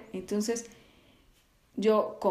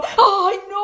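high, louder panicked "ay, no"; startled cry; 11 semitones higher, 9 dB louder than usual. Retold or imitated. Brother-in-law caught on the toilet, crying out. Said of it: "Y él solo dijo".

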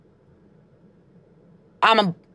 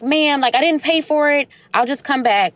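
higher voice of a flustered mother insisting she hasn't paid; flustered mom; 7 semitones higher than usual. Retold or imitated. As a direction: imitate the frazzled mom protesting to the cashier.

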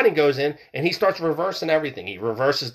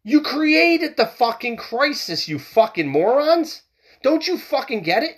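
angry rant; 9 semitones higher than usual. Real, not acted. Ranting at political opponents.

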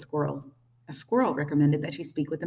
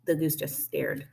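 usual speaking voice

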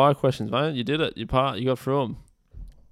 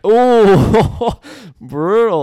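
shocked gasp; 10 semitones higher, 10 dB louder, much slower than usual. Real, not acted. Shocked by friend's pick.

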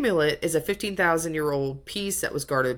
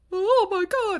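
high, loud mock gasp of shock; imitating a crowd's stunned oh my God; 3 dB louder, slower than usual. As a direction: squeal it like the whole room just gasped. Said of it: "And everyone's like".

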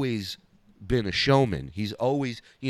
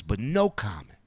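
Dice's cadence; 5 semitones lower than usual. Retold or imitated. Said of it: "It's the cadence".

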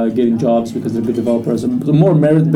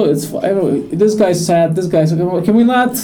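exasperated joking; 6 semitones higher, slower than usual. Retold or imitated. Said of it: "'cause I was like".

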